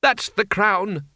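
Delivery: high, slow, tearful voice; nearly in tears. A narrator's reading. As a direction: drag it out, close to crying. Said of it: "nearly in tears".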